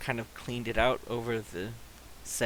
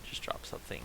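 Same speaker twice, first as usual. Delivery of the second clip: whispering; 4 semitones lower, 11 dB quieter, much faster than usual. Real, not acted. GM muttering aside to himself.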